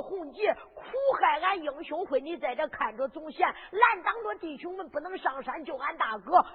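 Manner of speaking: ordinary delivery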